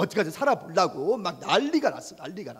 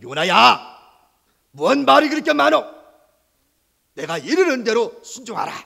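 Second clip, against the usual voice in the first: stern God voice; 5 semitones higher, 8 dB louder than usual. Acted. God scolds Jonah.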